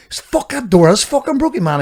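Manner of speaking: high, panicky mock voice of the mate; mate's high-pitched panic over the door; mate's alarmed outburst. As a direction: squeal it like the mate seeing his van broken. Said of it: "he goes".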